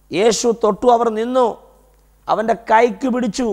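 high, measured preacher's declamation; declamatory preaching. Real, not acted. Preacher painting the funeral procession Jesus stopped, declamatory preaching. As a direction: proclaim each short phrase, held high with pauses.

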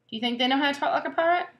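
plain reading voice

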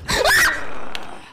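very high shriek of fright; startled scream. Real, not acted. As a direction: scream at a jump scare. Sudden enemy jump scare.

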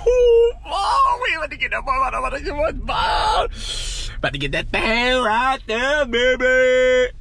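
high excited shouting; hyped squeal. Real, not acted. Sneaking in to surprise his friend, hyped high shouting. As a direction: whoop it up with a giddy high voice.

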